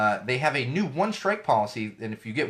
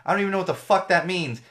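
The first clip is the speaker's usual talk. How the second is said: usual speaking voice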